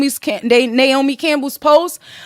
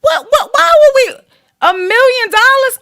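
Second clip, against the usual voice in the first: high, loud stammered disbelief; 9 semitones higher, 3 dB louder than usual. Real, not acted. Reacting to the million-dollar fee with high loud disbelief. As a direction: sputter at the price in shock.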